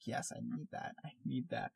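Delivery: breathy whisper, barely voiced; whispered inner thought. Fan taking notes mid-episode, whispering to herself. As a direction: whisper it like you're scribbling notes. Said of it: "I'm like".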